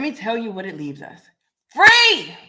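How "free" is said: a loud, high triumphant shout; loud exclamation. Real, not acted. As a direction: burst out with it. Exultant cry in podcast rant.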